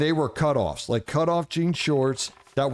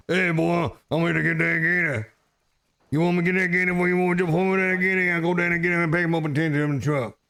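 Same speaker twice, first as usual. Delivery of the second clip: imitated Cajun accent, pitched higher; friendly Cajun accent done as an impression; 5 semitones higher, faster than usual. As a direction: mimic a rowdy Cajun gator hunter. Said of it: "the deepest Cajun accent".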